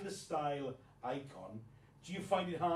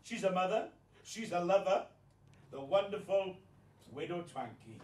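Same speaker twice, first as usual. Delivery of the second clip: showman's big announcement; grand introduction; 4 semitones higher than usual. Acted. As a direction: build it up like a compere. Introduces guest like compere.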